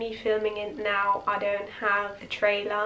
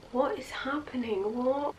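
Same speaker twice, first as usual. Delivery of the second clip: higher, softer baffled question; mock bewilderment; 4 semitones higher, 5 dB quieter than usual. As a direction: imitate a stunned onlooker, pitched up. Acting out two bewildered onlookers.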